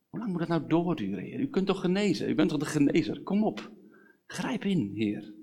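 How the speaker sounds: imploring prayer without raising the volume; urgent pleading. Acted. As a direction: press God to step in. Pleads with God about sickness.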